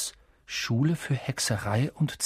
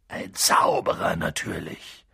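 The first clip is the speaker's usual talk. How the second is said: leise ruhig; 9 semitones lower than usual. Acted. Hagrid beruhigend.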